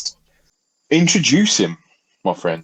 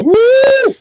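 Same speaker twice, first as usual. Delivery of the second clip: high, loud whoop; piercing, excited "woo"; wired whoop; 7 dB louder than usual. Shown as a demonstration. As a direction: let out a shrill over-caffeinated woo. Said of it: "so I'm a bit like".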